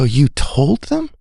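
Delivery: high, slowed amazed question; stunned she shared it; surprised. Retold or imitated. Father surprised daughter told friends, amazed. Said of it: "I go".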